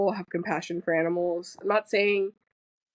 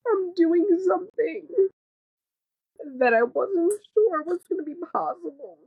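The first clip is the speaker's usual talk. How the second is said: awed; 10 semitones higher, 3 dB louder than usual. Real, not acted. Reliving profound moment.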